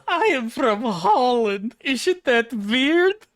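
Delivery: very high, loud, slow Dutch caricature; drawn-out falsetto with a silly Dutch accent; mock Dutch accent. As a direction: squeal it out as a hammed-up Dutch girl. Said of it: "six-foot-three blonde girl".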